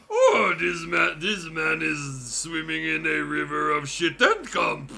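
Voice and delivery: slow, loud mock quote through laughter; laughing mockery. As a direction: parody chat while cracking up. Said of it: "it's like".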